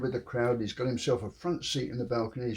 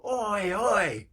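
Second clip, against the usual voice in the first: yelled from below; 8 semitones higher than usual. Acted. Man below yells up.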